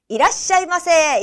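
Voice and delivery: shop clerk's high, loud welcome; loud, hearty greeting. Acted. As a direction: call out boldly to greet a customer. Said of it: "아주 호기롭게 큰 소리로 인사를".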